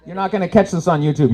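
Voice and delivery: ordinary talk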